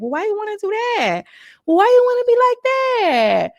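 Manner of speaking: small, gentle voice that wants no trouble; reluctant fighter pleading to avoid conflict, high and soft; soft, light voice. Retold or imitated. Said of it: "She's speaking so soft and so light".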